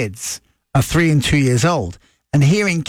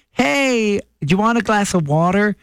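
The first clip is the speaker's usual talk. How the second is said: put-on kids' bright Canadian accent, higher; 6 semitones higher than usual. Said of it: "heard them talk and they were like".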